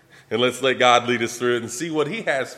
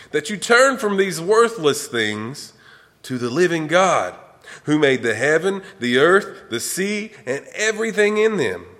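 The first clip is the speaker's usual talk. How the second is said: raised, high-pitched proclamation; shouting to a crowd; 6 semitones higher, 4 dB louder than usual. A narrator's reading. Apostles pleading with the crowd to stop sacrificing, shouted. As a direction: read it out high, as an urgent public appeal. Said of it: "rushed into the crowd shouting".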